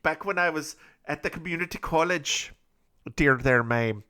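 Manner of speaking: a Scottish storyteller's lilt, lightly done; Scottish accent. Acted. Scottish man telling a coffee shop story, mock Scottish accent. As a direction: tell the tale in a Scottish accent. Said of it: "this accent I have right now".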